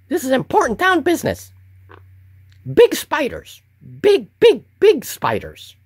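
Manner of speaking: very high, fast, eager goblin chatter; excited goblin guard. Acted. Goblin guard excitedly warning of big spiders, very high fast goblin voice. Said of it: "the town's guard replies excitedly".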